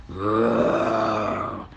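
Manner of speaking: long, low dragon roar; drawn-out low roar. A narrator's reading. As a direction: let out a low monster roar. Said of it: "the witch heard a roar".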